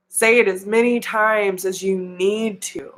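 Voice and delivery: ordinary talk, nothing marked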